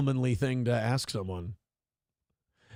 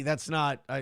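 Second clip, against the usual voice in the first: ordinary delivery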